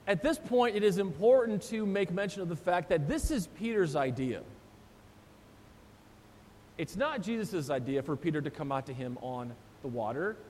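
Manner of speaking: ordinary talk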